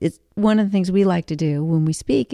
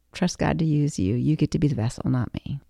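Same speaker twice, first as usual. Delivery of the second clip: normal talking voice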